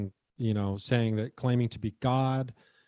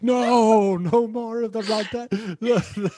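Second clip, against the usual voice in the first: high, loud, drawn-out wail of pretend dread; mock frightened printer; 11 semitones higher, 5 dB louder, much slower than usual. Scared printers hearing the story of Karen, hammed-up fear. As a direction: beg in hammed-up terror. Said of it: "I just see all these printers".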